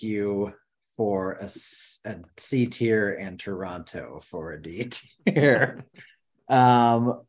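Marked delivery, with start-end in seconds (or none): none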